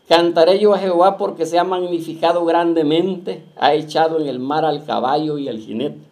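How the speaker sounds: loud, declaiming preacher reading scripture. Acted. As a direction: proclaim the verse to the congregation. Preacher reads scripture aloud. (lectura bíblica proclamada, fuerte)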